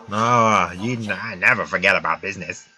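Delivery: put-on villain voice. Streamer voicing the game alien, low shady voice. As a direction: voice the alien merchant, low and quiet. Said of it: "Oh, I put a voice on".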